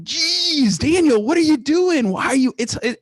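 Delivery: hammed-up high, baffled in-law at Easter dinner; mock exasperated father-in-law. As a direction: squeak out his disbelief at a phone call. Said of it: "my father-in-law is like".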